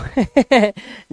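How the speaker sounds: short amused chuckle. Real, not acted. Teacher amused by own drawn-out example, chuckle. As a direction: chuckle briefly at yourself.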